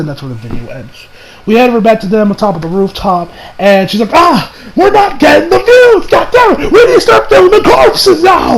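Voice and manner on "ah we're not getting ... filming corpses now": high, drawn-out mock tantrum breaking into laughter; hammed-up frustrated shouting. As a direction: wail and yell in comic exasperation. Acting frustrated video maker, exaggerated.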